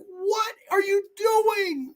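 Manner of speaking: a high, slow, drawn-out crying shout; shocked cry. Retold or imitated. Reacting to the movie scene in a high, shocked cry. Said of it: "I was like".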